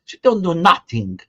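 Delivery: a breathy hushed Italian aside that jumps up high; Italian-American relative. Retold or imitated. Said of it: "they all sound like that".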